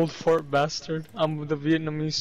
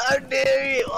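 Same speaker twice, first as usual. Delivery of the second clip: mock outraged shout; 9 semitones higher than usual. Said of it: "He's like".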